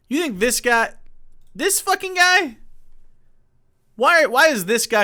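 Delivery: can you believe this guy, real exasperation; a real incredulous squeal; incredulous outburst. Real, not acted. Ranting in disbelief at the guest.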